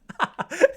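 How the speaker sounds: high, loud burst of laughter. Real, not acted. Amused by mum's note.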